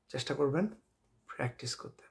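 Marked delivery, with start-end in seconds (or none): 1.3-2.0 soft whispered urging. Shown as a demonstration. Teacher whispering a reminder to practise, softer. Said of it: "urging practice".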